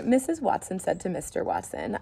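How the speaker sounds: ordinary delivery, nothing marked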